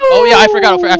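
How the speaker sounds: very high surprised oh, then rushed; sudden realisation. Real, not acted. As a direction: blurt it out as it hits you. Host suddenly remembers.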